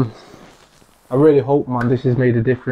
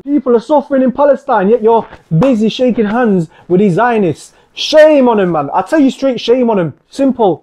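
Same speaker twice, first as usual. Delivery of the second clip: loud, high, indignant outburst; angry condemnation; 7 semitones higher, 5 dB louder than usual. Real, not acted. Denouncing a leader shaking hands, loud and outraged. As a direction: let the outrage out.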